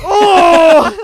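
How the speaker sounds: loud high whoop; hyped roast shout. Retold or imitated. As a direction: whoop at the burn. Crowd reaction to a burn.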